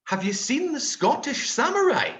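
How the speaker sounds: mock local asking, high and amused; laughing Prague local. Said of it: "People are gonna be walking around Prague going".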